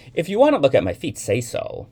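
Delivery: fast, high, loud challenge; irritated. A narrator's reading. Young man scolding the woman in the elevator, irritated. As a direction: burst out at a stranger.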